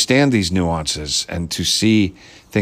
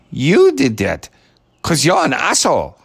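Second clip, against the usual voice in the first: put-on Ana's accent; 6 semitones higher than usual. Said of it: "that little accent from Ukraine".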